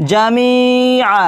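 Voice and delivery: জামিআ with the final আ held one alif long; lengthened alif. Shown as a demonstration. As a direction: stretch the আ of জামিআ. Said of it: "এক আলিফ টেনে পড়তে হবে". (জামিআ-র শেষ আ এক আলিফ টেনে পড়া)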